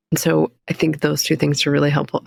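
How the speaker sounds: normal talking voice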